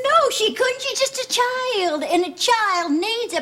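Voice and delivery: high, distressed contradiction; urgent protest. Acted. Mother defending little Heather's needs, urgent protest. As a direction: jump in high to contradict him.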